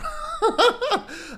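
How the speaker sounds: very high imitated laughter; put-on high cackle of ridicule; mock laugh. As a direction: laugh in their face, hammed up. Imitating a Japanese person laughing.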